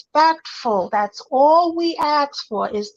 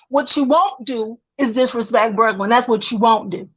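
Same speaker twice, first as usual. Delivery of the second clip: normal talking voice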